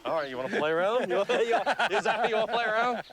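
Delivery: fast, high, laughing taunt; playful teasing. Real, not acted. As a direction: tease the animal, quick and giddy. Teasing the snapping lizard.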